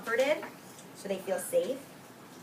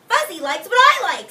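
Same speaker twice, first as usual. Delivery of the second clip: excited Owen; 10 semitones higher, 12 dB louder than usual. Acted. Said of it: "said Owen".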